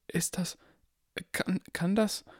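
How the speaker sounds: put-on high, halting old barkeeper; stammering barkeeper. A narrator's reading. Barkeeper stunned by Harry, high halting stammer. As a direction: stumble over the words in awe.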